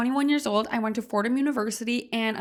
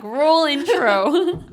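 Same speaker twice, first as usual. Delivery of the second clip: drawn-out, higher and louder show cue; mock intro call; 5 semitones higher, 9 dB louder, much slower than usual. Host cueing a nonexistent intro, mock announcement. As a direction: call for the intro with slow, loud fanfare.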